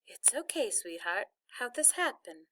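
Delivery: soft, tender, close to crying; gentle, near tears. A narrator's reading. Mother comforting her stuck son, gentle near tears. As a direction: soothe softly while holding back tears. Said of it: "sounded like she was gonna be crying".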